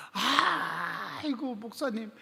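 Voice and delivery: a drawn-out crying "아이고"; crying wail. Retold or imitated. Deacon's wife weeping to the pastor, crying wail. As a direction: wail it through tears. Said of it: "우는 거예요".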